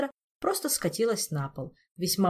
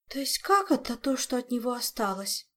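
higher-pitched gasp of horror; horrified Olga; 6 semitones higher than usual. Acted. Olga learning only remains are left, horrified high question. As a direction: question it in appalled disbelief. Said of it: "в ужасе ахнула Ольга".

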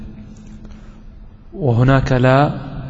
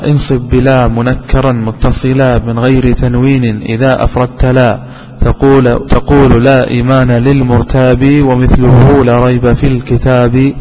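slow, louder chanted verse; poem recitation; 2 semitones lower, 4 dB louder than usual. Acted. Teacher recites a grammar verse to his students, slow and chanted. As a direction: intone the verse slowly and firmly.

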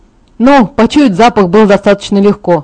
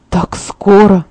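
plain reading voice

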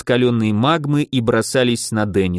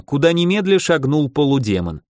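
usual speaking voice

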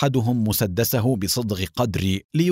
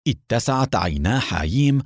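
ordinary delivery, nothing marked

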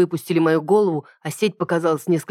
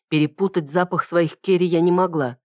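plain reading voice

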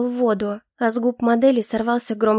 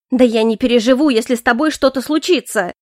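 louder desperate cry; heated outburst; 5 dB louder than usual. A narrator's reading. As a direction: cry it out, near breaking.

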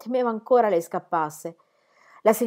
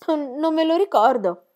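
put-on hesitant child; 9 semitones higher, 3 dB louder than usual.

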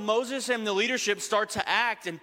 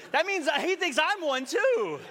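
high, drawn-out comic outrage; mock indignation; 10 semitones higher than usual. Son works out dad's insult, mock indignant. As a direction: stretch it out high, playing offended.

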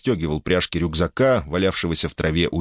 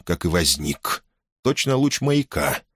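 usual speaking voice

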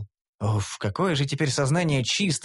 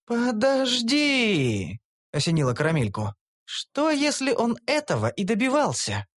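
higher, dawning suspicion; sudden realization; 8 semitones higher than usual. A narrator's reading. Elf suddenly suspecting hero's plan, surprised. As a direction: catch the thought mid-breath.

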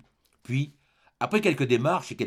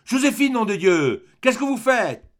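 loud, high, drawn-out yell; angry master's shout, loud and high; bellowing; 9 semitones higher, 7 dB louder, slower than usual. A narrator's reading. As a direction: bellow for the maid across the house. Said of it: "et il hurla".

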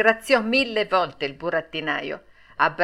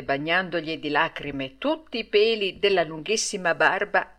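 usual speaking voice

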